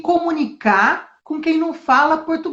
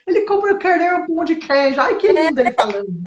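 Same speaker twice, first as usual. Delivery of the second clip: mock gushing; 5 semitones higher than usual. Teacher mocking Brazilians who find foreign accents cute, swooning. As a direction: coo over the cute accent. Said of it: "a gente entende e acha lindo".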